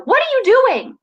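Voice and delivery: high, loud shocked shout; shocked yell at a child. Retold or imitated. Mother yelling at her youngest who unbuckled. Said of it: "heightened in my tone".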